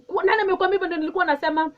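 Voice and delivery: loud, fast angry retort; open anger. Shown as a demonstration. Speaker showing the angry way of answering a misunderstanding, loud and fast. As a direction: snap back in angry disbelief. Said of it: "the other side of open anger and say".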